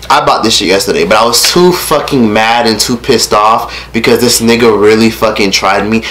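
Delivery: ordinary delivery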